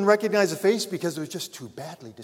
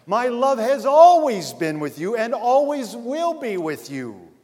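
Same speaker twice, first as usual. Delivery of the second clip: louder, higher preaching swell; preaching at full force; 7 semitones higher, 9 dB louder than usual. Acted. Preacher to congregation about God's love, fervent and louder. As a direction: lift the congregation with fervor.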